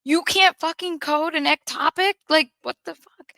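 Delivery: high, exasperated mock yell; retold screaming. Furious consultant to staff about coding an ectopic, retold scream. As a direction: imitate a doctor yelling in disbelief. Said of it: "he's screaming like".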